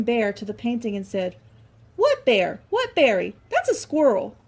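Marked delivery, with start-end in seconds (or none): 1.9-4.4 insistent Squirrel, high and loud; puzzled protest, higher and louder; lightly voiced squirrel.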